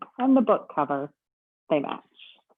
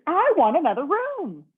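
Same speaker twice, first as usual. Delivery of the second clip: higher, louder, huffy demand; mock fuming; 10 semitones higher, 5 dB louder than usual. Mister Snore fuming at the bellhop, mock angry. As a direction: demand it in a huff. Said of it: "Mister Snore fumed".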